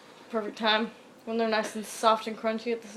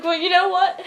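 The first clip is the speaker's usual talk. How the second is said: laughing, high and loud comeback; laughing retort; 8 semitones higher, 8 dB louder than usual. Retold or imitated. Kid shoving back at the big kids, laughing. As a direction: blurt it out through laughter.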